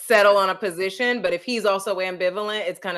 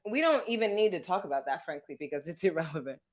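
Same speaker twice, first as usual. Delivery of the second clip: softer, offhand doctor's brush-off; tossed off quietly as if it doesn't matter; quiet dismissive quote; 6 semitones lower, 7 dB quieter than usual. Retold or imitated.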